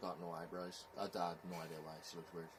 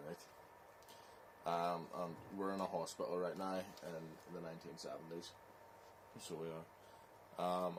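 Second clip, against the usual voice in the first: ordinary talk, nothing marked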